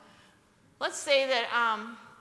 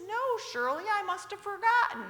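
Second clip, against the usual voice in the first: higher, sing-song fake innocence; mock innocent father; 9 semitones higher than usual. Father teasing his wife, mock innocent reply. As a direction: play dumb sweetly, teasing. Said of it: "father's fake innocent reply".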